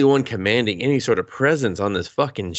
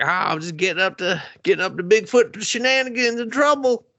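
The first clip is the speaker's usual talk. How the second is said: playful Bigfoot voice; 11 semitones higher than usual. Retold or imitated. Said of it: "voicing Bigfoot's mischief".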